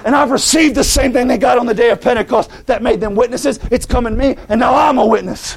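high, urgent sermon delivery; impassioned pulpit plea; fervent preaching. Acted. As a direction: press the congregation with rising urgency. Preaches with force to congregation.

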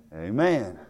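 usual speaking voice

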